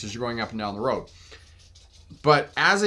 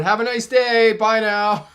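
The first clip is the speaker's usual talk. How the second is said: much higher and louder, sing-song goodbye; sing-song fake sweetness; 9 semitones higher, 7 dB louder than usual. To the cursing pickup driver, loud sing-song sarcasm. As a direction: chirp it like a mock-cheerful farewell.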